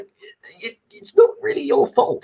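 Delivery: high, slow, pleading exasperation; exasperated plea. Retold or imitated. Fan pleading with the Doctor, high and exasperated. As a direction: beg her to stop apologising. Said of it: "I'm just like".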